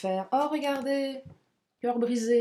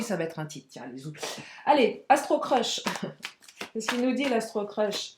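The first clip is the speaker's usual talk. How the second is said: usual speaking voice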